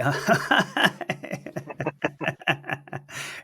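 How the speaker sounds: long, trailing chuckle; amused laugh. Real, not acted. Amused by the other's answer.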